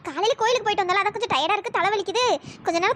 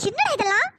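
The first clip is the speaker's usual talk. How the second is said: ordinary talk, nothing marked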